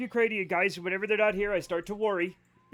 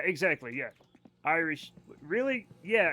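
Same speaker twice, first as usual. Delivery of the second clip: ordinary talk, nothing marked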